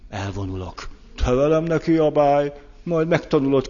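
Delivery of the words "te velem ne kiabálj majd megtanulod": higher, louder mock threat; a hurt partner warning the other not to yell; offended threat. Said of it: "Vagy rögtön megsértődök". (sértett fenyegetés)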